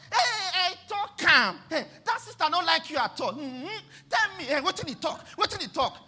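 high, loud Pidgin gossip, half-mocking; gossiping churchgoer. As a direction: ham up a nosy church busybody. Imitating gossiping church members.

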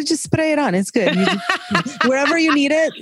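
laughing dad impression. Imitating Greek Wedding dad.

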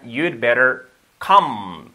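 very slow teacher's demonstration; deliberate, drawn-out classroom model; slow model sentence. Shown as a demonstration. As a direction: stretch each word out for learners to copy. Teacher models the phrase slowly.

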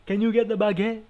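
put-on playful French-accent line. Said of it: "just said that in a French accent".

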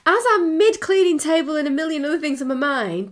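usual speaking voice

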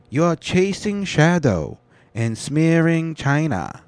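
lightly hammed foreign-ministry accent; mock Chinese accent. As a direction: ham up the spokesman scolding. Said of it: "the kind of slang they use over there".

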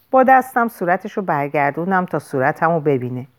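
plain reading voice

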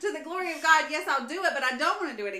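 loud, high, comic whining; grudging protest. Acted. Mom grudgingly agrees to God's chore, whiny protest. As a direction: give in while loudly complaining, half laughing. Acted grudging obedience to God.

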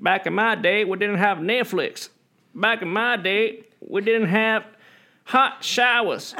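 put-on high, slow whiny old boomer voice; grumbling out of touch boomer. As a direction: grumble like an old boomer about kids today. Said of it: "your impression of a baby boomer".

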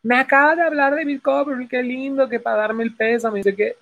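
high-pitched imitation of a touched woman; gushing widow. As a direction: read her message in a high, moved voice. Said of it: "la esposa escribe, la viuda". (viuda conmovida, imitada en voz aguda)

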